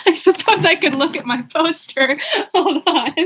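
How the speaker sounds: real laughter breaking in; laughing. Real, not acted. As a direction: giggle while you say it. Laughs while fetching poster.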